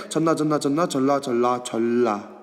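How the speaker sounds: 전라 repeated fast until it slurs toward 절라; sound out 전라. Shown as a demonstration. Teacher sounding out 전라 for the class, repeated quickly. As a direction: say 전라 over and over so it runs together. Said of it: "전라 발음하면 어때?".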